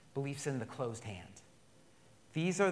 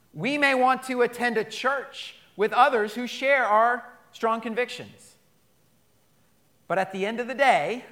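rally the congregation, slowing on the key words; preaching at full force; 8 semitones higher, 11 dB louder than usual. Acted. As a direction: pastor rallying the church, loud and high. Preaching climax on unity.

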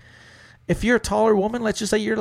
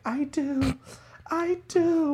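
high breathy-free squeaky yes; mock eager bride; 13 semitones higher, 5 dB quieter, much slower than usual. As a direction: gush it out like a starstruck bride. Girl accepting proposal.

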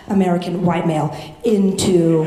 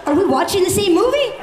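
high, quick disbelief; incredulous retort; 11 semitones higher, faster than usual. Retold or imitated. Daughter to mom during the movie, incredulous. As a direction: fire it back much higher, baffled. Acted retort to mom's complaint.

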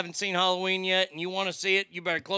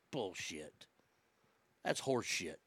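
under the breath, much softer and lower; muttered disgust; 16 dB quieter than usual. Retold or imitated. Retelling his reaction in the theater, a low muttered grumble. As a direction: grumble it to yourself in the seat. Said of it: "I'm like".

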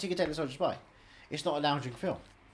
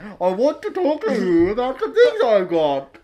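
slow, louder put-on drawl of a film character; mock film voice; 9 dB louder, much slower than usual. Mocking the film character's phone line to a friend, slow put-on voice. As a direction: stretch each word like a hammy actor. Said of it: "he's like".

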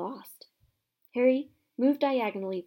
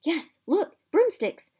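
excited; 8 semitones higher, 7 dB louder, slower than usual. Acted. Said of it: "children spotting broomsticks in chamber".

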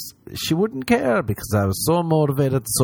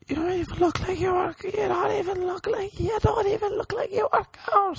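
very high shrill taunting voice; much higher mocking squeak; squeaky mocking voice; 4 dB quieter than usual. Mocking Instagram commenters.

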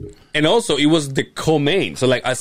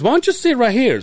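much higher, louder sing-song offer; teasing friend voice; 12 semitones higher, 4 dB louder than usual. Retold or imitated. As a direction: pitch it up like a smug wingman. Jose setting him up.

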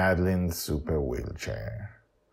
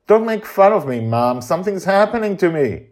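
high, loud whiny plea; man's petulant plea; upset son; 13 semitones higher, 10 dB louder than usual. Acted. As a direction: protest like a hurt kid. Justin pleads with mother.

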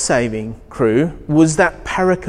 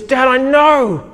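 put-on high, earnest little girl; young daughter announcing she's been bitten; daughter's voice; 8 semitones higher, slower than usual. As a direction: play the stung eight-year-old calling to Dad.

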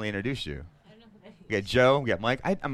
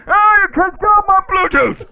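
glitchy robot voice; 13 dB louder than usual. Acted. Same glitch voice, laughing.

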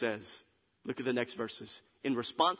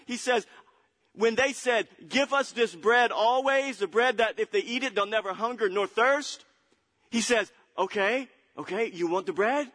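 raised, emphatic pulpit voice; forceful preaching; 8 semitones higher, 6 dB louder than usual. Real, not acted. Preacher pictures the scene for congregation, forcefully preaching. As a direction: press each question on the congregation.

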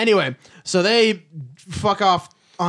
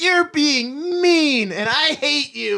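put-on whiny child voice; 8 semitones higher, much slower than usual. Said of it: "all of her lines are like".

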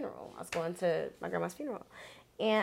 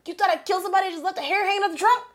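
high, fast, loud disbelief; laughing incredulous retort; 12 semitones higher, 9 dB louder, faster than usual. Retold or imitated. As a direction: fire it back in amazed disbelief.

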